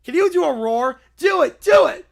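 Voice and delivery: put-on whiny child yelling do it; demanding kid. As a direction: insist like a spoiled child, pushing harder. Kid demanding tiger roar.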